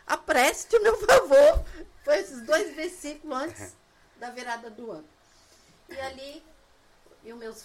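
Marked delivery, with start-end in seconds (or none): 0.1-1.6 loud, high, drawn-out mock demand to God; imploring appeal. Woman claiming scripture in a retold prayer, loud imploring. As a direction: belt out the retold plea slowly. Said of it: "Aí eu apelei".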